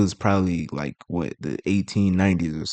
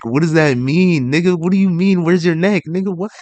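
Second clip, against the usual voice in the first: high, loud incredulous questioning; bewildered, raised-voice pushback; baffled disbelief; 8 semitones higher, 5 dB louder than usual. Real, not acted. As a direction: demand to know what it means, utterly thrown. Baffled reaction to the odd insult.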